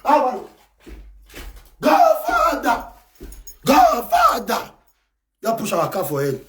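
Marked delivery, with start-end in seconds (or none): 1.8-4.7 high, slow, drawn-out call; mock fawning call. Mocking a fawning fan calling godfather, high and slow. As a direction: stretch it out like a sycophant.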